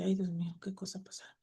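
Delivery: hushed, sighing near-whisper; imitated worried sigh. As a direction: let the quoted worry sink very soft. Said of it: "suspira y dice".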